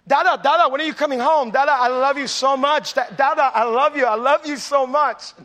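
put-on be a small kid gushing love at dad; eager little kids. Kids call a third time.

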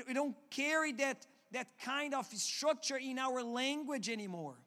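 ordinary talk

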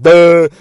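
loud, high "duh!"; dramatic sting. Acted. Narrator marking the shock reveal, loud mock sting. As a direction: blurt a mock shock chord.

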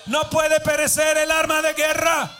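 building to a loud shouted preach over music; fervent shouting preacher over music; preaching at full force. Real, not acted. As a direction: cry it out to the congregation.

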